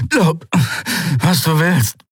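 high, pressed-out words; forced, strangled pirate voice; strained Sephiron. Acted. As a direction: squeeze it through the pain. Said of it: "presste Sephiron hervor".

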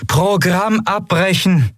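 high, slow, emphatic order; commanding, high-pitched call; urgent command. A narrator's reading. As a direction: order a machine to stop. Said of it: "befahl Aylan".

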